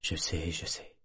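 low and very soft, fading away; breathed murmur. A narrator's reading. Lover answering her, mouth against her skin, breathed murmur. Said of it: "souffla-t-il, la bouche contre sa peau".

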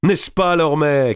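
high cry over the wind; shouting. A narrator's reading. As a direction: call out to him at full gallop. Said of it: "cria-t-il dans le vent de la course". (crié dans le vent de la course)

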